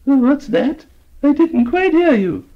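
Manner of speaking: put-on high, thin old lady voice; old lady asking. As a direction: play a half-deaf old lady. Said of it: "said the old lady".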